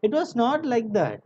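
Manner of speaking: "It was not like that" pitched high throughout; rare emphasis. Retold or imitated. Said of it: "said with the rare emphasis".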